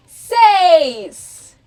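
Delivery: seis called out loud; triumphant seis. Shown as a demonstration. Counting up with the children to six, a loud triumphant call. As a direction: land seis like a big reveal. Counting lesson, climax number.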